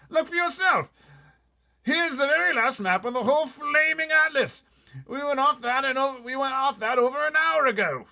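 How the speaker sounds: blustering officer exploding; shouting Air Force chief. Acted. Air Force head shouting at the pilot, outraged.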